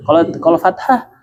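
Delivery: normal talking voice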